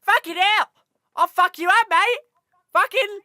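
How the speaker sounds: high, shouted fake-Australian chav voice; chav enemy accent. Game enemy threatens the player, shouted mock chav accent. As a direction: yell threats as a hammy chav, slipping into Australian. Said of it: "all the enemies are just like".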